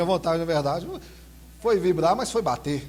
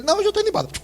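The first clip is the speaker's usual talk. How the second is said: put-on much higher, bratty child voice; little boy's voice; 5 dB louder, much faster than usual. Pastor imitates his small son answering him in the mall, high childish voice. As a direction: squeak it like a small kid.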